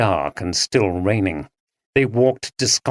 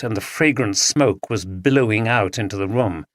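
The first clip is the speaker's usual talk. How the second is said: ordinary delivery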